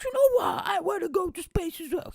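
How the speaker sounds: a clumsy put-on voice for a billionaire he finds annoying; bad Richard Branson impression. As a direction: go way up into a silly falsetto to mock him. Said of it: "He doesn't sound like that, I cannot do his accent".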